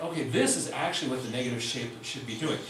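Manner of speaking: ordinary delivery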